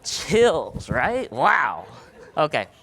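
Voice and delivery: high, loud burst of delight; thrilled. Real, not acted. Pumped up after the audience's power clap. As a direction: cry out in real excitement. Reacting to the crowd's big clap.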